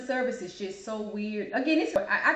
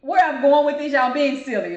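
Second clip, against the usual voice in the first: loud; 5 semitones higher, 10 dB louder than usual. Real, not acted. Loud scolding of viewers.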